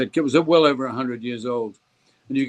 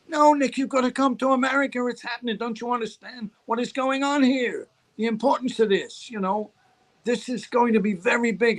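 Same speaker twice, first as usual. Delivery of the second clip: higher-pitched urgent voice, pressing the case; pleading agent; 8 semitones higher than usual. Retold or imitated. Agent begging Nick to come to America, urgent pleading. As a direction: plead insistently in a raised pitch.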